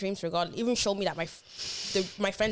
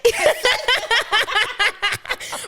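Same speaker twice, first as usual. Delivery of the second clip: loud high laugh; 9 dB louder than usual. Real, not acted. Telling her friend the story, a loud high laugh.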